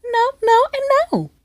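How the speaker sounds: very high, cracking into a laugh; exasperated no. Retold or imitated. Refusing the last guy, squealing high into laughter. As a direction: squeal the nos until you crack up.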